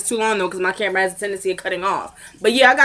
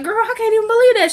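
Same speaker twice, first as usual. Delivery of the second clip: put-on high girly voice; 13 semitones higher than usual. Friend's reaction retold in high voice.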